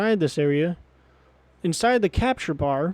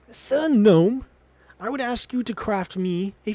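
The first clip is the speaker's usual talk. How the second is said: theatrical raised-voice greeting; formal character address; 4 semitones higher than usual. Acted. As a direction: hail the gnome grandly, pitched up. Retakes the line in character voice.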